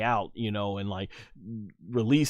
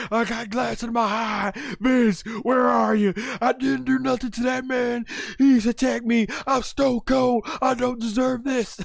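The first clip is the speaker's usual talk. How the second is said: imitated high, loud distressed wail; hammed-up wrestler protesting an attack at the top of the voice; Stone Cold; 12 semitones higher, 4 dB louder than usual. Acts wrestler complaining after glass attack.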